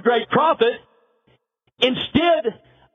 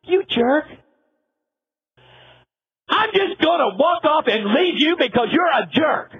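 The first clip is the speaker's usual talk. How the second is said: angry shout; 5 semitones higher than usual. Acted. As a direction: yell it like you've had enough. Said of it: "God should have said".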